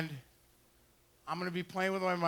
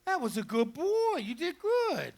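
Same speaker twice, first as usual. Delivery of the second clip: much higher, happy praise; dog praise voice; 8 semitones higher than usual. Real, not acted. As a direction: celebrate the dog. Praising dog after retrieve.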